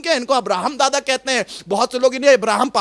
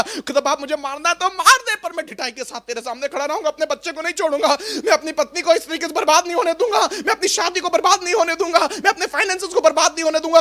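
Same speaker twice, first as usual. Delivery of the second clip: higher, fast, insistent vowing; bold defiant prayer; 5 semitones higher, faster than usual. Acted. Believer vowing to God to save child and marriage, boldly defiant. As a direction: act out a stubborn, unyielding plea, pitched up. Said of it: "ढिठाई के साथ खुदा के सामने खड़ा होना".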